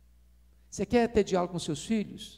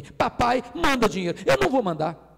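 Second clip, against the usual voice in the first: higher, louder indignant read; outraged father reading the message; angry reading; 5 semitones higher, 6 dB louder than usual. Retold or imitated. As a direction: bark out the son's request.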